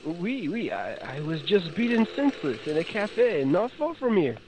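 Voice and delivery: put-on high, flustered French policeman accent; nervous French officer, stammering; French gendarme voice. Plays beaten-up French gendarme.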